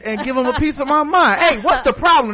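high, loud, puffed up bravado; tough guy voice. Retold or imitated. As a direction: act hard and ready to fight. Acted confrontation, tough talk.

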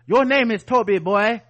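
loud, commanding slave-master bark; harsh master order. Pretend. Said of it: "So are they gonna be like".

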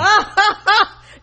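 loud high burst of laughter; real loud laugh. Real, not acted. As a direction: burst out in a quick, high cackle. Co-host reacts to ominous setup.

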